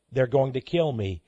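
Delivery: ordinary talk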